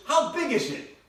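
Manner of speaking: loud, high call across the house; yelled question. Retold or imitated. Wife yelling from the bedroom, loud and high. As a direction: shout it from the other room. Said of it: "she yells out to me".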